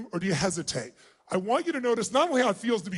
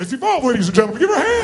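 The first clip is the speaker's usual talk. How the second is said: announcer call; 2 semitones higher, 9 dB louder, faster than usual. Real, not acted. Announcing a performer to crowd.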